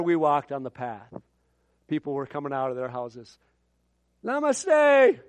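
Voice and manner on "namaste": villager greeting. Retold or imitated.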